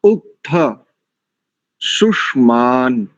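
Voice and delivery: उक्थ शुष्मान spoken slowly, each syllable drawn out; slow recitation demo. Shown as a demonstration. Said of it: "दूसरी पंक्ति देखिए".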